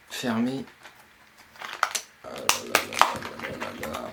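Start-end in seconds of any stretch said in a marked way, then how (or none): 2.2-4.1 breathless ah là là under the breath; a low whispered sigh of frustration; exasperated muttering. Real, not acted. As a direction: grumble at the stubborn packaging. Struggling to open the box.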